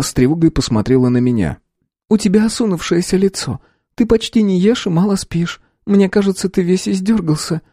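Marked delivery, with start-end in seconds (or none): 2.1-7.6 higher sister's voice, gently worried; a sister fussing over her tired brother; caring sister. A narrator's reading. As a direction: sister tells her brother he looks worn out, with concern.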